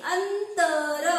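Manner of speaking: very slow "अंतर" with a clear n; slow model reading. Shown as a demonstration. Teacher shows the n sound in "अंतर" to pupils. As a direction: stretch out "अंतर" and let the n ring. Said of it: "न चा उच्चार झाला की नाही?".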